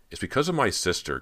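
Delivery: high, loud, sudden confession; blurted out. A narrator's reading. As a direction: burst out with it, pitch jumping up. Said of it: "Cody blurted it out".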